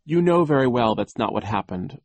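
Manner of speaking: sharp contradiction; indignant interruption. A narrator's reading. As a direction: cut in and object firmly. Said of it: "Violet interrupted".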